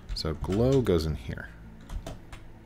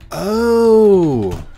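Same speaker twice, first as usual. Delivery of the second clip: long, high, loud "oh" sliding down; loud realisation; 12 semitones higher, 13 dB louder than usual. Real, not acted. Player grasping which wood was meant, loud drawn-out realisation. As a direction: draw it out loud and high as it dawns.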